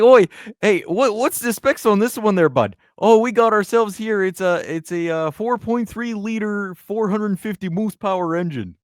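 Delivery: high-pitched, hammed-up Canadian accent; exaggerated Canadian twang, high and loud; Canadian caricature. Mimics Canadian car buyer and dealer.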